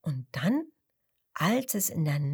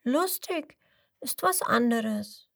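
little crocodile's voice; 4 semitones higher than usual. A narrator's reading.

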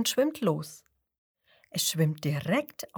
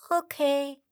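high meek peep; small high meek okay; little crocodile peeping; 7 semitones higher than usual. A narrator's reading. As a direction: peep it like a tiny creature. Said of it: "piept das kleine Krokodil".

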